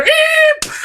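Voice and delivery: imitated high-pitched "eep"; very high mock shriek; high startled screech. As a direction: squeal it like a sudden screech. Imitates screech as kid runs out.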